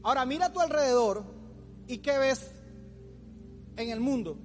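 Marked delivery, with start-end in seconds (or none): none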